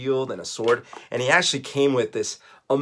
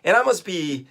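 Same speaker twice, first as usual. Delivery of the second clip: ordinary delivery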